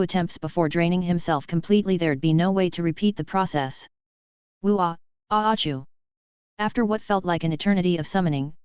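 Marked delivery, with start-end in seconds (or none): 4.6-5.8 building wind-up into a sneeze; a cute creature's big sneeze. Acted. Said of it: "Worm sneezed violently".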